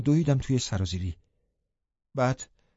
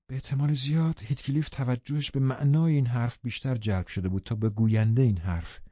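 ordinary delivery, nothing marked

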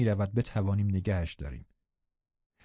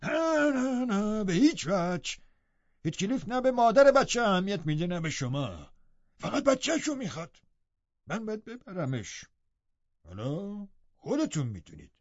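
put-on very high, insistent old-servant voice; stubborn servant giving orders, pitched way up; imperious Joseph; 8 semitones higher than usual. A narrator's reading. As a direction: lay down the law in a high character voice. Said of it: "کاملاً آمرانه گفت".

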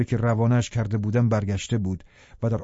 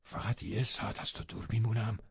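very soft, hushed plea; quiet entreaty to a lover; pleading; 12 dB quieter than usual. A narrator's reading. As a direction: beg gently, almost under the breath. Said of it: "با التماس گفت".

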